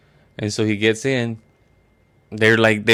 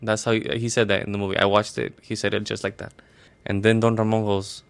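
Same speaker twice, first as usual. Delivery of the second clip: normal talking voice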